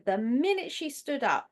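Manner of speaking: ordinary talk, nothing marked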